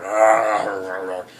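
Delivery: loud guttural zombie arr; zombie growl. Retold or imitated. Zombie heard growling in the distance, loud growl. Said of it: "you hear the zombie going".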